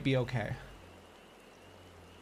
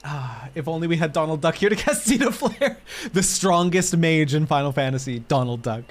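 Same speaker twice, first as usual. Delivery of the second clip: usual speaking voice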